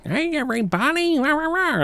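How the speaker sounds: very high goofy cheering voice; squeaky nonsense cheer; silly weird voice. Retold or imitated. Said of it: "silly, weird voice".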